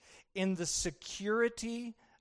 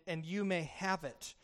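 normal talking voice

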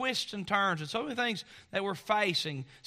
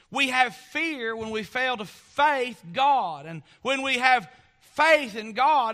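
preaching; 4 semitones higher, 6 dB louder than usual. Real, not acted. Preacher rising to a strong, forceful call to faith. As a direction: lift the church toward faith, building power.

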